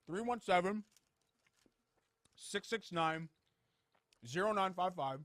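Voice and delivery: phone number through a mouthful of chocolate; talking with a full mouth. Real, not acted. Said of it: "you gotta say the number without your mouth full".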